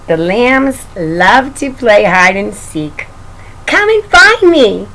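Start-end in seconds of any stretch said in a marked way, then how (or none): 3.6-4.9 playful lamb voice. Acted. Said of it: "said Little Lamb as she skipped".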